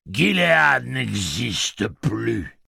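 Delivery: slow, loud, very high booming voice; a huge voice from the ceiling quibbling; booming machine voice. Acted. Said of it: "ergota la voix".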